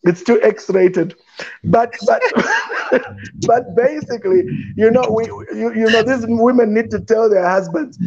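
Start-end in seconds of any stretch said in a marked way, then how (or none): 2.3-3.4 high laugh. Real, not acted. Laughing at the X-rated joke.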